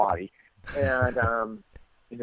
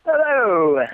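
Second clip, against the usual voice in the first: big, high, loud hello; booming trademark hello to the radio hosts; signature entrance greeting; 10 semitones higher, 7 dB louder than usual. Acted. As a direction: burst in with a showman's hello. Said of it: "That's your signature entrance".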